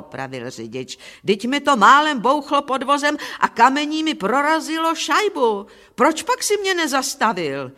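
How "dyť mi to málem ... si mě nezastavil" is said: high, acted outrage; indignant driver demanding an explanation; indignant scolding. A narrator's reading. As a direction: scold him with raised pitch. Driver angrily scolds Petr.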